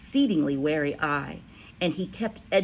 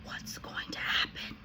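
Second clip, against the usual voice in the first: hushed unvoiced whisper; scared schoolgirl whisper; 8 dB quieter than usual. A narrator's reading. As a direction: whisper it, afraid to be heard. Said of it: "Lavender whispered".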